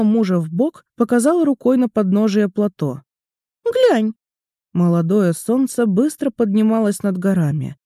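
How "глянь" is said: put-on high little girl voice; squeaky, playful small girl; Mora's voice. Mora speaks, higher voice.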